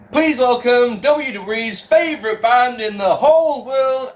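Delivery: high, slow, drawn-out introduction; hammed-up arena announcer presenting a band; mock announcer. Announcer introducing the favourite band to the arena, showy.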